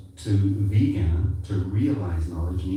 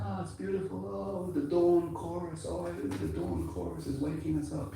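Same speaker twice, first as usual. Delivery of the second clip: high, soft, wistful gushing; dreamy bird lover; 9 semitones higher, 8 dB quieter than usual. Retold or imitated. People swooning over the dawn chorus, in a high hushed gush. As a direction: coo it like a rapt nature lover. People admiring the birds' song.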